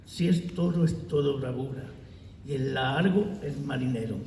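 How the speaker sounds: loud, measured declaiming of verse; poem recitation. Acted. Poet reads his décima in honour of his friend Carlos, loud declamation. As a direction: declaim each line out to the room, pausing between verses.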